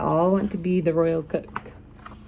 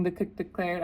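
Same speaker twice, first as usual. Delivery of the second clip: plain reading voice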